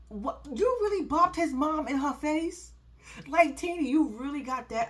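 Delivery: high, incredulous question; can't believe she did it; disbelieving. Real, not acted. Reacting to the slap.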